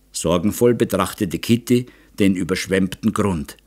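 usual speaking voice

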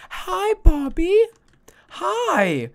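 high, slow cooing to a pet; baby talk. Acted. Greeting her new pet Bobby in a sweet baby voice. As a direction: coo at him like a baby.

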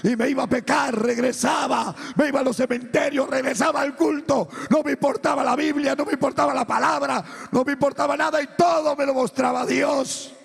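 high, loud, driving testimony; preaching at full force. Real, not acted. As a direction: shout each confession in rhythmic bursts.

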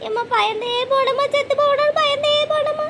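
put-on slow, rising childlike wail; drawn-out crying after a bad scare; tearful wail. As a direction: wail out the fright, stretching the words. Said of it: "அழுதுட்டு கிடக்கியே சின்ன பிள்ளை மாதிரி".

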